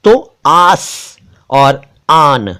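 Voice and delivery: आस and आन stretched slowly, long clear aa; drawn out so learners catch the long aa in आस and आन; careful Urdu pronunciation. Shown as a demonstration. Teacher showing viewers the long aa, slowly. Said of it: "उच्चारण पर ध्यान दीजिएगा".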